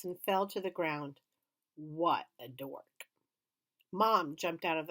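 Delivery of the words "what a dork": scorn. A narrator's reading. Scornful narrator aside.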